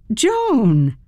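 startled greeting; surprised. A narrator's reading. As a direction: caught off guard by a visitor. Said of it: "I was surprised to see her".

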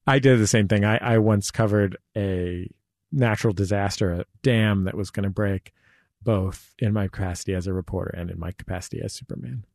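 ordinary talk, nothing marked

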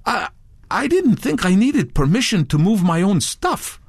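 stumble, then protest defensively; flustered protest. A narrator's reading. Touré defending himself to Redbeard, flustered.

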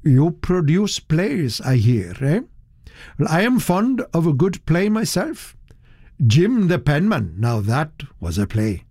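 a genial bore starting small talk; lightly voiced bluff gentleman; hearty businessman. Hearty guest making conversation.

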